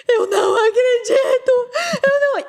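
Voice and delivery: high, loud, tearful disbelief; retold hysterical scream. Mother at the school reception screaming in shock. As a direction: shriek it out as if the floor gave way. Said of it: "E eu comecei a gritar".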